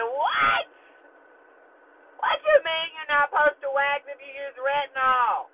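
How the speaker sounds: high, loud, outraged disbelief; shocked shout. Retold or imitated. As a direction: shriek it back in horror. Acted shocked reply to waxer.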